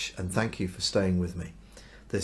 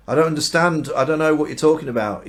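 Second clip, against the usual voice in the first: flustered denial; 6 semitones higher, 8 dB louder than usual. Acted. Peter denies knowing Jesus.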